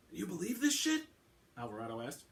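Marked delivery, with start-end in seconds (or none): 0.1-1.1 much higher, scoffing cop voice; mock the whole idea as a bad TV plot; sceptical detective. A narrator's reading. Alvarado voiced in audiobook.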